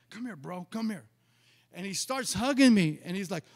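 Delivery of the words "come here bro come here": lightly voiced big man, softened; a hint of a big guy, speaking softly; big guy's greeting. Prophet pulling pastor into a hug, lightly voiced big man.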